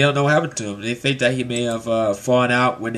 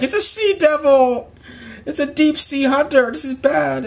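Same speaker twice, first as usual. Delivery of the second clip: mock panic; 13 semitones higher than usual. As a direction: sound a hammed-up warning. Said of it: "And it was like".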